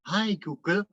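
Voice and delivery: a hint of a wife coaxing the device; wife's sweet call. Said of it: "Istri saya bilang". (meniru istri, nada tinggi lembut)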